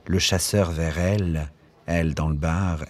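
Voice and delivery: usual speaking voice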